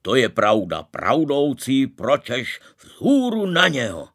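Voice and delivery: loud, booming battle call; rally the crowd with a roar; deep voice. A narrator's reading. Maharaja calling everyone to charge, loud booming voice. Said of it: "odvětil hlubokým hlasem".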